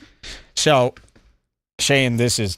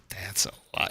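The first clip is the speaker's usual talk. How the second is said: barely voiced, very soft murmur; hushed aside; 7 semitones lower, 12 dB quieter than usual. Host acts his reaction while scrolling, hushed low aside. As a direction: drop it almost to a whisper. Acting out his private remark.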